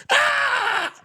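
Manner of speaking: a sudden high, loud scream; imitated scream. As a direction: voice the man's panicked scream. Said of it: "hanging upside down screaming".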